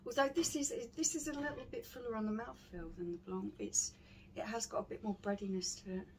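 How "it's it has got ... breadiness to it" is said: ordinary talk, nothing marked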